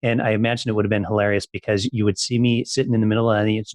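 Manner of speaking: ordinary delivery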